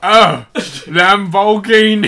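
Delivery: loud, high, drawn-out English butler; Michael Caine. Acted. Mimicking a film actor for laughs, slow loud Cockney. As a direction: stretch it out in hammed-up Cockney. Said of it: "Michael Caine is awesome".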